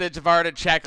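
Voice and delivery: ordinary talk, nothing marked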